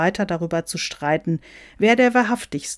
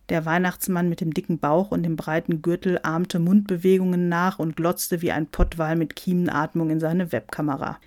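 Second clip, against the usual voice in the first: ordinary delivery, nothing marked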